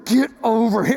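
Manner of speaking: ordinary talk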